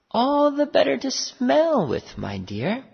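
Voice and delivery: high, lightly put-on granny voice; wolf as grandmother. A narrator's reading. Wolf in bed fooling the girl, pretend grandmother voice.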